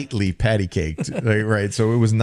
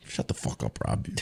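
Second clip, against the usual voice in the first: mock whispered snap; 11 semitones lower, 11 dB quieter than usual. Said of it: "it's just like".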